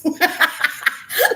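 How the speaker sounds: sudden high cackle at her own joke; high burst of laughter. Real, not acted.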